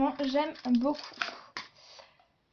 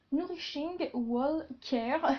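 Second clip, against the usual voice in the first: slow, hammed-up English accent on the brand name; slow playful English accent; mock English accent; 3 semitones higher, much slower than usual. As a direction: say it like a proud but clumsy English speaker. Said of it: "Vous admirez mon, mon magnifique accent anglais".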